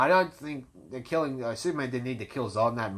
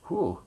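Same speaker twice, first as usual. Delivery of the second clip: heavy breathless whew; out of breath exhale; 7 semitones higher than usual. Real, not acted. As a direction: blow out air, winded. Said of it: "Ran out of breath there".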